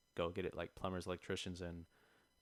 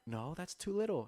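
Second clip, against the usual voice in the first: high, incredulous protest; mock indignant customer; 8 semitones higher than usual. Retelling the tile customer's protest about grout, mock indignant. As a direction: toss the objection up high in disbelief. Retold customer objecting again.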